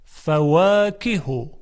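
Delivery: فواکه drawn out slowly as fawakihu; slow recited word. Shown as a demonstration. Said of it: "همچنین کلمهٔ". (فواکه را آهسته بخوانید)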